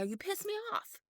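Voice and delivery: high mock accusing; upset retort. To her boyfriend, mock accusing. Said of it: "And I go".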